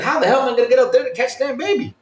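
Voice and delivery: frustrated exclaim. Retold or imitated. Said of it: "And you're like".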